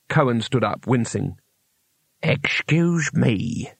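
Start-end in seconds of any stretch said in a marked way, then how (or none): 2.2-3.7 very slow, deliberate character voice; said flatly. A narrator's reading. Cohen interrupting the talk of biscuits, flatly. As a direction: draw it out slowly with mild deadpan. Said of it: "he said flatly".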